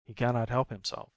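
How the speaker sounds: ordinary delivery, nothing marked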